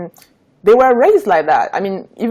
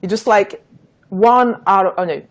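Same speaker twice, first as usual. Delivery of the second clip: ordinary talk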